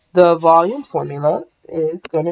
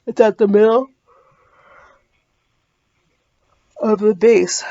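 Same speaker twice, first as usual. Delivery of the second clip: yawning; 5 semitones higher, 3 dB louder than usual. Real, not acted. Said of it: "excuse me".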